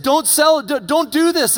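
high, loud begging; acted panicked protest, pitch way up; desperate plea. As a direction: plead urgently with your captors. Young Joseph begging his brothers, acted.

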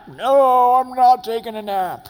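put-on a defiant child refusing the nap; loud, high hammed-up no, I'm not; stubborn refusal. Stubborn reply to take a nap.

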